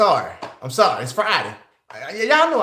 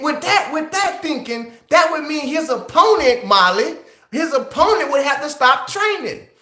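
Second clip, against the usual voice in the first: higher pitched, heated arguing; excited rant; 7 semitones higher, slower than usual. Real, not acted. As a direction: pitch it up and press every point. Heated rant at chat member Molly.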